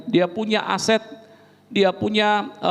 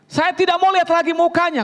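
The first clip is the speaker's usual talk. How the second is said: higher, insistent complaint; a husband fed up and demanding; angry husband; 9 semitones higher than usual. Retold or imitated.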